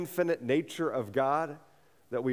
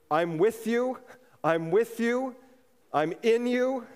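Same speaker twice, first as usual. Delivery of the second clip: emphatic assurance; 8 semitones higher, 4 dB louder, slower than usual. Retold or imitated. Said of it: "God is already saying".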